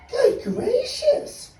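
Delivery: high squeaky gasp; a high bird's surprised gasp. Acted. Maisie the bird spotting Horton, high gasp. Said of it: "gasped Maisie".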